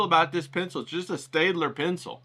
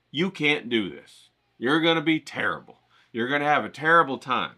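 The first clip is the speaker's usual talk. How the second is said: ordinary delivery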